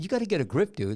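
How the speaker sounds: animated retelling. Retold or imitated. Retelling what he told the raging driver, exasperated. Retelling again after calmness was questioned.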